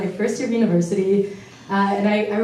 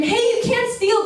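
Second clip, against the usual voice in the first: mock scream. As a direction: cry out in a slam poem without truly screaming. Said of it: "I wanted to scream".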